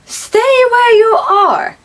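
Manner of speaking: high, drawn-out warning to a spider; nervous command. Real, not acted. Ordering the spider to stay.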